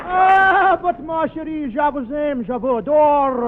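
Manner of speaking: crooning French words, slow on adore; Charles Boyer French. Acted. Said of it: "I'm Charles Boyer".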